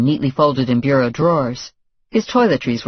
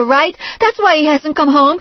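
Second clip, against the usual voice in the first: high and loud; convincing herself; rising panic; 5 dB louder than usual. A narrator's reading. Girlfriend panicking about Peter, high and loud.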